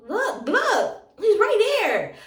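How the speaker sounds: high, loud, emphatic urging; insistent pointing-out. Retold or imitated. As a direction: imitate an exasperated girl calling attention. Girl insistently points out her brother.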